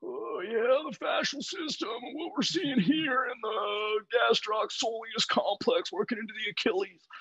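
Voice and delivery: high, slow mock-lecturing coach voice; Dan. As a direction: put on a drawn-out, high caricature of a technical coach. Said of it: "Like, he'll be like".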